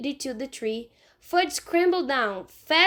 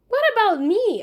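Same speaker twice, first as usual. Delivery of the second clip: higher, louder whine; indignant protest; 9 semitones higher, 7 dB louder than usual. Acted. Peter protesting to Mom about being left out. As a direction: complain to Mom.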